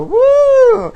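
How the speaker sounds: loud high demo whoop; piercing woo for the fans; pride whoop. Shown as a demonstration. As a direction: show them how to scream it. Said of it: "you have to go".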